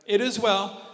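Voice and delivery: loud and firm: It is well; bold faith. Shown as a demonstration. Preacher proclaiming the woman's faith loudly. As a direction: declare It is well with full force. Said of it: "not vomiting fear".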